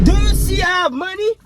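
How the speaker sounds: angry shout. Acted. Dad yelling at kid.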